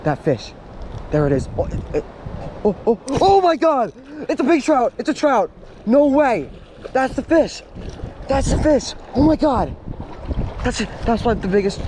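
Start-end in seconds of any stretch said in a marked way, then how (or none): 2.6-11.8 real excitement. Real, not acted. Angler spotting a giant trout, high excited shouts. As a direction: gasp it out like you just saw a monster fish. Spots huge trout while fishing.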